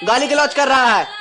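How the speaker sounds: high-pitched heated protest; angry quarrelling. Acted. Son tells uncle to wait till wedding is over, heated protest. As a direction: argue back hotly, pitch held high.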